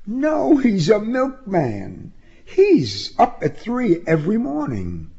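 matter-of-fact female voice, pitched well up; high-voiced young woman. A narrator's reading. Woman explaining her father's job, high-voiced. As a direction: correct him in a high woman's voice.

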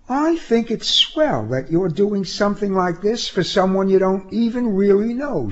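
high, sweet-talking female voice; high-voiced young woman, appreciative. A narrator's reading. Woman praising the driver she barely knows, high-voiced. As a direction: compliment him in a high, even lilt.

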